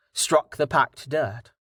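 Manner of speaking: ordinary delivery, nothing marked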